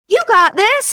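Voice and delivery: high, loud cheer from the line; rally behind her like a stranger in the crowd. Retold or imitated.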